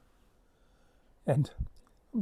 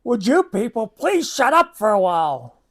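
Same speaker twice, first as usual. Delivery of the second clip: loud, higher, exasperated outburst; mock-polite yell; 5 semitones higher, 9 dB louder than usual. Teller snapping at loud guys on the bus, sarcastically "calm" yell. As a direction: yell the polite request at noisy passengers. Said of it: "I very calmly and politely said".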